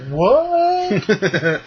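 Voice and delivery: high, drawn-out mock "what"; imagined incredulous reaction. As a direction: play up someone's baffled surprise. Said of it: "He'll be like".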